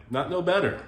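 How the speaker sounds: high, loud mock disbelief, quick at the end; incredulous scoff. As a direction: blurt it out as if the idea is absurd. Scoffing at wife's car idea.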